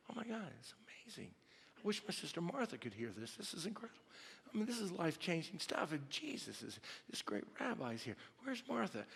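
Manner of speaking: breathless fast whisper of wonder; excited whisper. Retold or imitated. Mary at Jesus's feet, awed whisper to herself. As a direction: hush it like you're in awe.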